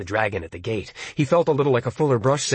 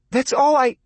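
sharp, louder and higher; sharpened voice; 11 semitones higher, 4 dB louder than usual. Acted. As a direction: cut in sharply. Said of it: "her voice sharpened".

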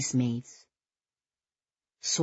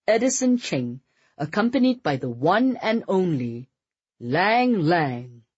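high, ringing master of ceremonies; booming announcement; 6 semitones higher, 4 dB louder than usual. A narrator's reading. Reverend presenting Edison Cheng at the pulpit, booming. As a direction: boom out each title like a grand introduction. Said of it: "His voice came out booming".

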